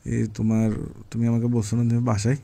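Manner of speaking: normal talking voice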